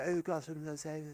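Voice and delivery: very soft middle-class English murmur; gentle middle-class English. Retold or imitated. Comic imitating the kindest people he knows, hushed middle-class English accent. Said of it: "lovely middle-class English accent".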